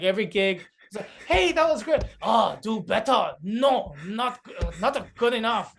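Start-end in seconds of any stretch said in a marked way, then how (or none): 1.3-2.0 high, loud mock praise from a strict parent; playing the harsh inner parent; a hint of a strict parent. 2.2-5.7 put-on slow, scolding Japanese-accented parent; strict parent in a Japanese accent. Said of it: "That's a Japanese accent".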